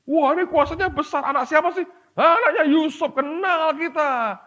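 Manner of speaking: high, mocking gossip voice; high, scoffing villagers; doubting townsfolk. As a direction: sneer at the local boy, slowing on the last words. Nazareth townsfolk doubting Jesus.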